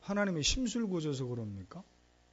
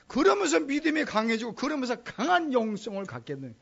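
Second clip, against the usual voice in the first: preaching at full force; 7 semitones higher, 8 dB louder than usual. Real, not acted. Preaching climax.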